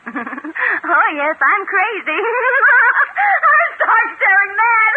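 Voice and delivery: laughing wildly, high and loud, words stretched out very slowly; mad laughter. Acted. Lucy proclaims her madness to the detectives, laughing wildly. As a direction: cackle your way into madness. Madwoman's outburst.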